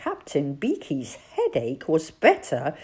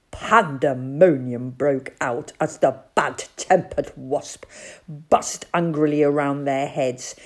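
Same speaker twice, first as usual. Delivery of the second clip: ordinary delivery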